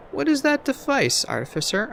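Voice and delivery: high, polite enquiry to the artificer; polite question. Acted. Narrator asks the artificer politely, high-pitched. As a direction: ask her gently and politely. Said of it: "I asked politely".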